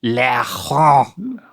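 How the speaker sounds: "Laurent" with a drawn-out throaty r and silent t; French pronunciation. Shown as a demonstration. Coaching Dad to copy it, "Laurent" in French pronunciation. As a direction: exaggerate the French "Laurent", nasal ending rising. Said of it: "Try it".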